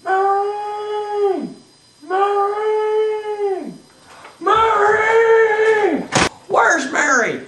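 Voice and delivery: high, long-held calls for someone; calling out. Acted. George runs home looking for Mary, calling out. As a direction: holler the name through the house.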